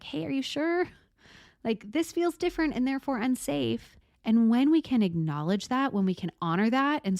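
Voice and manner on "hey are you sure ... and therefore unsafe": higher, cautious, questioning voice; wary nervous system. Pretend. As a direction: voice the inner alarm gently. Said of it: "your nervous system saying".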